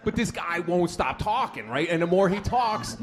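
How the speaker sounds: normal talking voice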